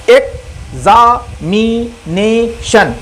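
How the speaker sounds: Examination broken slowly into syllables, a gap after each. Shown as a demonstration. Teacher showing students what a syllable is, syllable by syllable. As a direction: say examination in slow, separate beats: ek-za-mi-ne-shan. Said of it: "syllable क्या होता है कि जब हम बोलते हैं".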